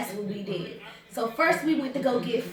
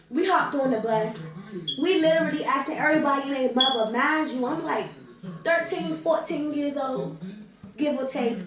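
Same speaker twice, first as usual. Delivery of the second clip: ordinary delivery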